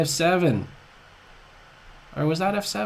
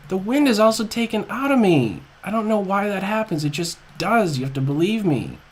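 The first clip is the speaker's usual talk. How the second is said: usual speaking voice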